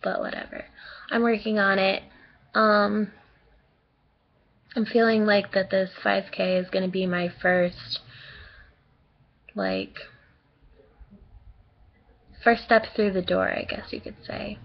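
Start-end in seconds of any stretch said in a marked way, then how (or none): 0.0-0.6 breathy shrugging whatever; whispered dismissal. Real, not acted. Dismissive aside. 13.5-14.6 whispered aside. Real, not acted. Trailing aside.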